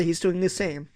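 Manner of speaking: usual speaking voice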